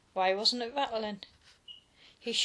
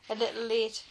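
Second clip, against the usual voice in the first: usual speaking voice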